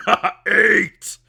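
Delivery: high, loud ogre outburst; bellowing ogre. Acted. Ogre punching the other ogre, bellowing. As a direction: roar it like a brawling ogre. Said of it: "punches the other ogre in the face and goes".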